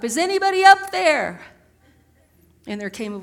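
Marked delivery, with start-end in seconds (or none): 0.0-1.4 loud, high-pitched yell for help. Acted. Man hanging off a cliff calls up for help, yelling. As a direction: holler it upward to anyone who might hear. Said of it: "He yelled up".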